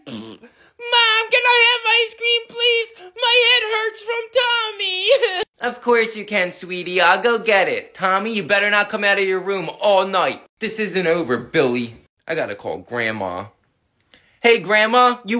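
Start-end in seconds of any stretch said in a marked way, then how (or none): 0.1-5.4 put-on high pleading boy; whiny kid begging. Kid begging for ice cream. 7.9-13.5 deep, dropping threat; low menacing voice. Acted. Mother threatens, then schemes.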